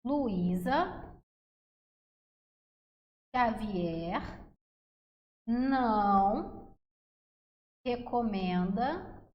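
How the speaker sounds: slow, with long pauses between words; measured memory-phrase dictation, waiting after each word. Shown as a demonstration. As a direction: dictate it one word at a time, drawn out. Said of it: "Vai ser assim".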